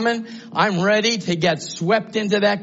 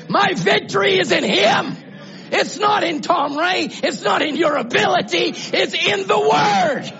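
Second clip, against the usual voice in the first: high, loud declaration of faith; full-force preaching; 10 semitones higher, 4 dB louder than usual. Real, not acted. Preacher telling the church where victory lies, shouted preaching. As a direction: shout it out, building to the last word.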